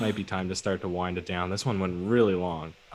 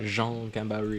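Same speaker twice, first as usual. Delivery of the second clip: drawn-out French pronunciation of the name; mock French; 3 semitones higher, slower than usual. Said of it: "hope your name is French".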